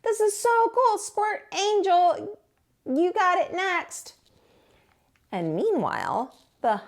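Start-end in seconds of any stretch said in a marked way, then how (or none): 0.0-4.1 much higher, giddy voice; Tacky having too much fun; gleeful Tacky. Acted.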